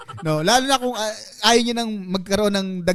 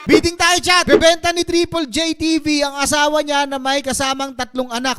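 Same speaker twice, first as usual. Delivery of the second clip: auctioneer; 4 semitones higher than usual. Pretend. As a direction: call the bids like an auctioneer. Mock auction to chat.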